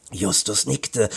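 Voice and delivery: ordinary delivery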